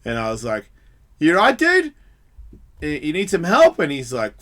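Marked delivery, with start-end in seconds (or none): 1.2-3.8 higher, louder check on a stranger; concerned calling out. Retold or imitated. Passer-by calls to man lying in gutter, concerned calling out. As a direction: call out to someone lying down.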